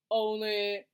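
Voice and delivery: slow, flat monotone reading. Shown as a demonstration. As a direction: drone it like a bored reader. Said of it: "read in a monotone voice like this".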